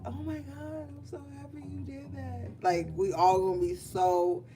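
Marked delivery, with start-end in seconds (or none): 0.1-2.5 high, soft mock-gushing; excited for a friend. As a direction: gush softly in a high, hushed voice. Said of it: "All excited and shit".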